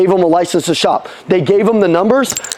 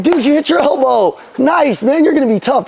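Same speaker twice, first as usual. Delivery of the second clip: upbeat dad pep talk; 6 semitones higher than usual. Retold or imitated. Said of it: "I'm like".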